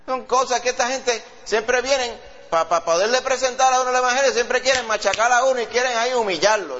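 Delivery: high, offended, protesting tone; indignant know-it-all. Retold or imitated. Preacher mocks the wise man rejecting the gospel, high and indignant. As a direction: huff like a proud man feeling insulted. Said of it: "aquel que dice que es entendido".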